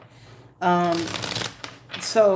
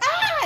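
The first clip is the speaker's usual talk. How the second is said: sudden very high shriek; retold scream. Teen at Woolworth chased by water bug, mock shriek. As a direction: let out a startled scream. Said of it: "And I'm screaming".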